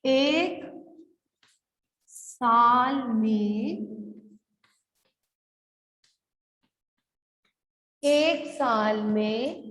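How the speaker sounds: very slow, word by word with long pauses; dictation. Shown as a demonstration. Teacher dictating a question to pupils, slow spaced-out delivery. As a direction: stretch each word so pupils can write it.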